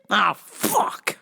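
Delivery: ordinary talk, nothing marked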